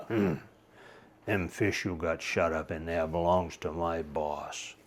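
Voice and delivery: low, gruff sea serpent grumbling; surly and cross. Acted. Sea serpent warning Charlie off, low and surly. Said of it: "kinda surly and cross".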